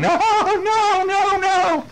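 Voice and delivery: high yowling cat in a panic; high, playful terrified-cat screech; scared cat. Acted. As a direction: wail it like a frightened cat. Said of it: "That's a scared cat".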